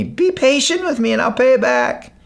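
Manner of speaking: kneeling servant pleading for mercy; begging. Acted. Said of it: "begged him".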